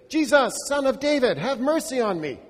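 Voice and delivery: loud desperate cry, high. Acted. Blind man shouts to Jesus for mercy. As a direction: shout to be heard over the crowd. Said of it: "at least he will hear me".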